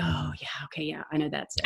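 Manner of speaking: soft whisper of sudden recognition; hushed, unvoiced okay-I-get-it; whispered realization. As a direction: breathe it out like a quiet aha. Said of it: "I'm like".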